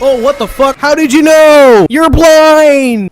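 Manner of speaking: loud, high yelling at the enemy; furious shout. Real, not acted. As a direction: scream the injustice at the game. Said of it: "how many times I fucking raged".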